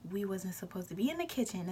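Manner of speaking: hushed sneaky whisper; sneaking into the kitchen at night, whispering. Retold or imitated. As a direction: whisper like you might get caught.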